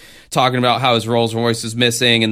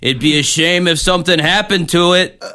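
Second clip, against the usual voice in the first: smiling menacing loan shark; 5 semitones higher than usual. Pretend. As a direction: lean in like a threatening gangster. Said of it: "he's like".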